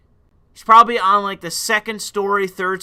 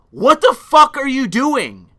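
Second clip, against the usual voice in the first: angry confrontation; 6 semitones higher, 5 dB louder than usual. Retold or imitated. Confronting man in garage.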